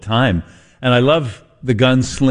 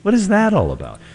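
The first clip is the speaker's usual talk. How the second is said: high-pitched, incredulous questioning; puzzled squeal of a TV pundit; Tucker Carlson; 8 semitones higher, faster than usual. Real, not acted. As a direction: jump up high in bewildered complaint. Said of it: "I just sounded like Tucker Carlson".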